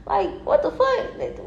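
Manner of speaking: high, slow, laughing what the; a high incredulous laugh-question; laughing disbelief. Real, not acted. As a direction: stretch it out, amused and stunned.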